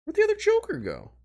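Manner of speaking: very high mock-whine; exaggerated squeaky repeat.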